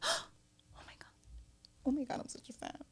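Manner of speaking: soft, high gushing; starstruck fan. Retold or imitated. Stranger approaching at the food spot, starstruck. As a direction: imitate an awed fan after a gasp.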